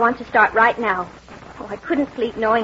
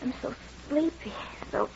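drowsy fading; 3 semitones higher, 13 dB quieter than usual. Acted. Drugged woman drifting off.

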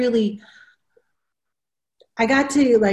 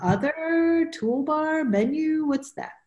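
higher, softer puzzled voice trailing into a question; confused learner; 8 semitones higher, 4 dB quieter than usual. Pretend. Teacher imagining a baffled user hearing options without a visual, confused. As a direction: lightly mimic someone lost among menu options. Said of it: "it would be like".

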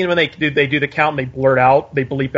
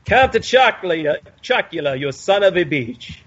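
Bela Lugosi. Retold or imitated. Mocking cereal mascot in Bela Lugosi accent. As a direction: ham up a Lugosi Dracula accent. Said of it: "if you're gonna speak like Bela Lugosi".

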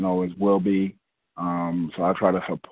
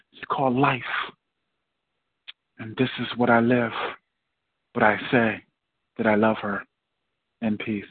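spoken-word poem; 7 semitones higher than usual. Acted. Poet on the open mic, reciting a poem to the audience. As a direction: perform it like a poem on a stage.

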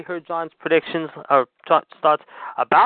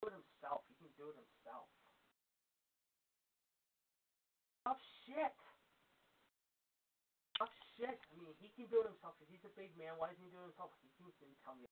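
far from the mic, much softer, grumbling complaints; off-mic phone argument; 16 dB quieter than usual. Real, not acted. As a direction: complain to the caller from across the room. Host arguing on phone off mic.

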